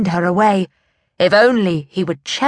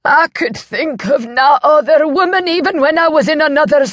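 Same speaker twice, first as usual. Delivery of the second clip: anguished outburst; 9 semitones higher, 5 dB louder than usual. A narrator's reading. Old man's anguished confession.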